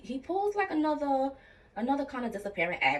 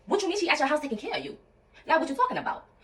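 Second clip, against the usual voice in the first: indignant inner voice; 8 semitones higher, faster than usual. Retold or imitated. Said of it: "In my head, I'm like".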